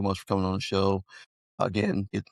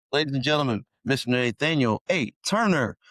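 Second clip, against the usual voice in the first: playful announcer flourish, higher on the last name; show announcer; 4 semitones higher than usual. Pretend. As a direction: present the guest like a ring announcer. Host formally announces the guest's name.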